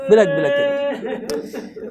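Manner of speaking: slow, wailing بلک بلک, as if sobbing along; tearful, drawn out. A narrator's reading. Preacher to mourners, lamenting Hurr's weeping.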